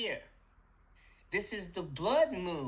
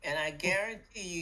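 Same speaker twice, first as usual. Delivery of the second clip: normal talking voice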